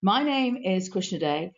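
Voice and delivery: ordinary talk, nothing marked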